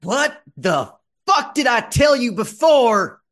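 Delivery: put-on high, loud drunken yell; drunk angry shout. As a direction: bellow at the trespasser, drunk. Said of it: "hefting her gun up and saying".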